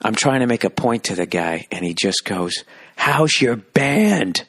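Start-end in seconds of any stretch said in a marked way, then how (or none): 3.0-4.4 very slow, high mocking jab; unbelievably sarcastic old-guy dig. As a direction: stretch out each word to sneer at them. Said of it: "He said it unbelievably sarcastic".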